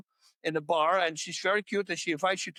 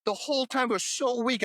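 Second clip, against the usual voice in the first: ordinary talk, nothing marked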